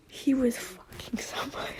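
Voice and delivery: unvoiced whisper; softly whispered, barely voiced; hushed. Real, not acted. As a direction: whisper it so no one outside hears. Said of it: "I have my grandma and grandpa outside".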